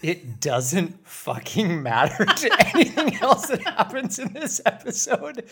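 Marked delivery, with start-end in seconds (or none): 1.8-5.5 high, laughing rant. Real, not acted. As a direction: laugh through the words. Laughing while ranting.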